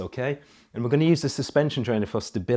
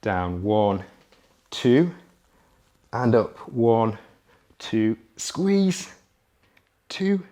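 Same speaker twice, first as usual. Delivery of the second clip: coach counting reps; 3 semitones lower, much slower than usual. Acted. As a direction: call out each rep like a trainer.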